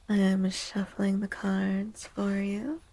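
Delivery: usual speaking voice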